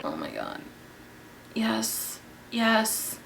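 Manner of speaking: savoring it quietly; hushed delight. Real, not acted. Vlogger quietly relishing her glowing skin.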